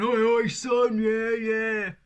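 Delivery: loud blokey "oi oi son" jeer; mock the lads cheering him on; laddish banter. Imitating dads and uncles egging a son on, loud laddish jeer.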